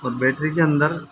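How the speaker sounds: normal talking voice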